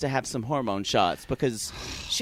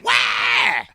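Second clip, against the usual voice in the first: louder wailing mock cry; mock baby shout; 6 dB louder than usual. Playing herself as a newborn, mock wailing shout. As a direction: yell it like a fussy infant. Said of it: "popped out of your mother's vagina and said".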